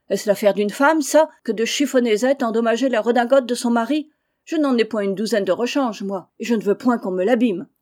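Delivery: loud quarrel with his wife; angry shouting. Acted. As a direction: bawl him down in a spat. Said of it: "criant à qui mieux mieux". (mari qui crie contre sa femme)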